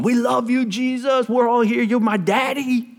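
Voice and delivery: high, strained voice imitating a young leader; over-casual youth leader praying. Young leader praying before junior high campers, mock high gushing. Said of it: "as he continued to go on".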